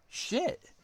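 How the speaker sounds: high, loud, yelping hero voice; Sonic voice. Acted. Sonic yelping after Shadow punches him. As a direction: yelp it out as the punched hedgehog. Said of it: "said Sonic".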